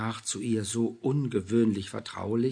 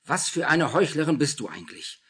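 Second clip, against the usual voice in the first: higher, louder scorn; contemptuous; 5 semitones higher, 7 dB louder than usual. A narrator's reading. Julia scolding Anna, contemptuous. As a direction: snap at her with disdain. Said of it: "fuhr Julia sie verächtlich an".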